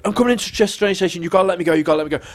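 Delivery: high, rushed pleading; urgent plea. Retold or imitated. Acted urgent plea by phone.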